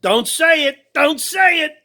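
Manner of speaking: hammed-up tough-guy warning, pitched high; Joe Pesci impression. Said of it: "Just like Joe Pesci says".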